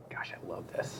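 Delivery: soft breathy whisper to himself; whispered thought. Retold or imitated. On the road trip with his kids, whispered to himself. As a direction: hush it like a private thought.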